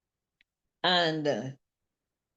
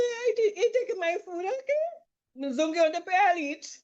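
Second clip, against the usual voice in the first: laughing retelling; 9 semitones higher than usual. Retold or imitated.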